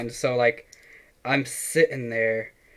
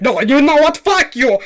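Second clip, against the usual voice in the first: much higher, louder hammed-up Indian caller accent; Indian scam caller accent; 14 semitones higher, 9 dB louder than usual. Retelling a scam call, hamming up the caller's Indian accent loudly. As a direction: ham up the eager Indian caller. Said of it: "like, an Indian guy".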